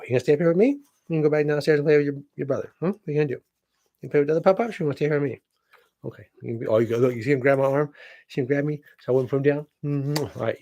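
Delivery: pet talk. Real, not acted.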